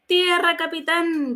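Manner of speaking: high, loud shouted cry of land. Acted. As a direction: bellow the first word to the captain. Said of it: "Gómez otra vez".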